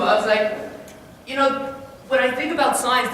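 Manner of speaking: ordinary talk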